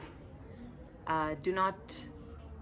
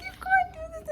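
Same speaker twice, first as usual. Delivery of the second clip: very high, loud squealing protest; mock whine; 4 dB louder than usual. Locked-in teacher protesting her aunt's photo: high squealing whine. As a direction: whine like an outraged child. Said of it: "I'm like".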